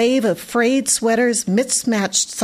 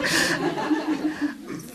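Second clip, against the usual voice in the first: breathy laugh; 7 semitones higher, 6 dB quieter than usual. A narrator's reading. Laugh in narration.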